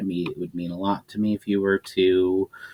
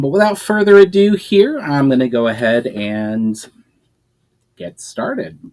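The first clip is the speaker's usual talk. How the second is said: plain reading voice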